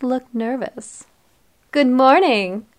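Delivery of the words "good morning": bright loud cheerful greeting. A narrator's reading. Barber greeting a mom and sons, cheerful. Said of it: "I said cheerfully".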